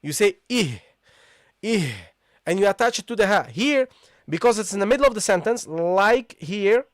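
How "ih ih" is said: ih opened with a short i, then a breathed h; short isolated ih; hamza read aloud. Shown as a demonstration. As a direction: start ih on the vowel and let the h sound. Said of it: "At the start you read it".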